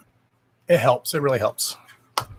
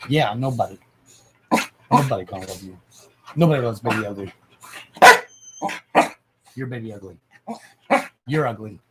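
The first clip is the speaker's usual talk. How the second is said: low cooing taunt at the dog; a hint of doggy baby talk; pet talk voice; 4 semitones lower than usual.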